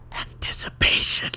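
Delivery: soft, whispered single word; hushed whisper. Acted. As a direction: whisper it like a secret. Character reveals what the sea feels.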